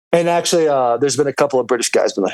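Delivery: ordinary talk, nothing marked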